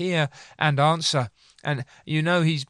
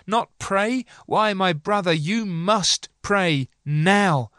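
higher, pleading sermon, rising on Not pray; fervent preaching; 4 semitones higher than usual. Acted. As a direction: press the hearers with mounting urgency. Urgent exhortation to pray.

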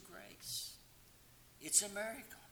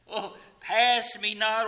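joyful cry; 6 semitones higher, 8 dB louder, slower than usual. Acted. Hymn cry with laugh.

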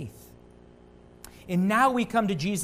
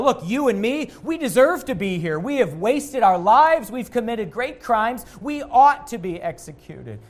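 urgent confession; 4 semitones higher, 6 dB louder than usual. Retold or imitated. Preacher voicing repentant criminal.

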